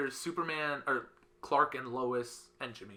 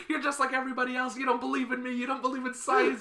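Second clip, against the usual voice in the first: put-on high, loud and fast, a petulant teen rant; mock whiny Lex; 11 semitones higher, 5 dB louder than usual. Teen Lex whines at the dying Clark in a high, fast rant. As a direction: rattle off the grievances like a sulky misunderstood genius.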